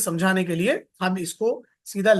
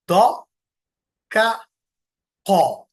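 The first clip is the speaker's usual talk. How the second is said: ordinary talk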